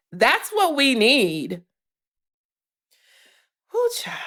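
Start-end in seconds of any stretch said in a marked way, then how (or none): 3.7-4.2 worn-out exhale. Real, not acted. Exhaling after long rant.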